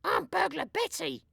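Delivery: very high lady burglar; Burglar Betty voice. A narrator's reading. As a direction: announce herself sweetly. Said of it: "says the lady".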